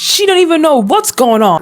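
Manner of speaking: ordinary delivery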